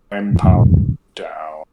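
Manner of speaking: low, slow shutdown voice; powered-down robot. Pretend. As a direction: sink into a flat power-off drone. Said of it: "just be like".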